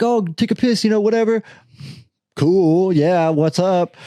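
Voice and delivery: high-pitched, offhand mimicry; imitated easygoing girls. Girls telling him go ahead, high and casual.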